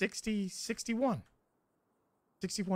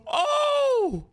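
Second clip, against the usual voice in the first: screaming with joy, laughing; triumphant shout; 9 semitones higher, 5 dB louder, much slower than usual. Real, not acted. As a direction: cheer the win at full voice. Vault opens, celebration.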